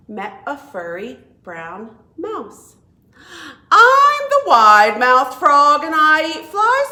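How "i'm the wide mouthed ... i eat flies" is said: loud, boastful frog voice, much louder; proud frog. Acted. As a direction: announce it like a showy frog. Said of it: "said the wide-mouthed frog".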